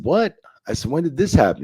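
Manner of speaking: high, loud, incredulous what; stunned by the news, voice jumps up; shocked disbelief. Retold or imitated. Hearing the ban news, shocked and loud.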